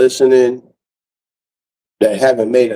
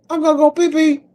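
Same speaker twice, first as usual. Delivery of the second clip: silly high voice. Real, not acted. Streamer telling chat he needs the bathroom, childish high voice.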